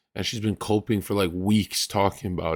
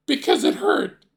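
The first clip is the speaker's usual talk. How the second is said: put-on much higher, louder childlike whine; mimicking young Ray's innocent reply; naive kid answer; 7 dB louder than usual. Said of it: "and he said".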